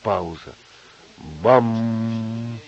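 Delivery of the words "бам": long, held "bam" on one note; imitated clock chime. A narrator's reading. The radio striking midnight, ringing imitation. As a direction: let the bell stroke ring on.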